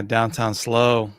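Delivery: normal talking voice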